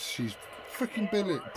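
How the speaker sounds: usual speaking voice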